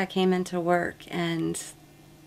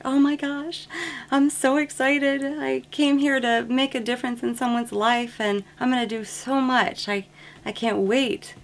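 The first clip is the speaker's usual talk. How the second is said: higher, gushing new-nurse voice; bubbly excitement; 7 semitones higher, 3 dB louder than usual. Retold or imitated. A fresh nursing graduate gushing about her first job, gushing excitement. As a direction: act out a brand-new nurse bursting with eagerness. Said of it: "you're like".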